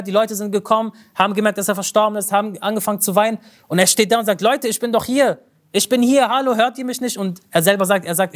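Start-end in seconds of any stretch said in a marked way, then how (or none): 4.4-7.2 higher, urgent calls nobody hears; calling out. Retold or imitated. The dead sheikh calls to the weeping crowd, higher and pleading. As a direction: plead to be heard, pitched up.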